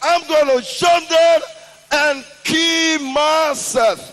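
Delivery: man on a skyscraper shouting his ultimatum; shouting threat. Retold or imitated. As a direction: yell the threat down to the city. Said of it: "and he was shouting".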